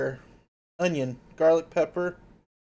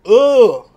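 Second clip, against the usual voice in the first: loud ugh; 7 semitones higher, 17 dB louder than usual. Real, not acted. Hiccup after tasting sauce.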